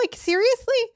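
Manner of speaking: very high-pitched exasperated disbelief; incredulous. Acted. Parent fed up with non-committal child, incredulous. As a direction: squeak it out in mock disbelief.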